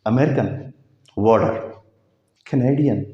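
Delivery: ordinary talk, nothing marked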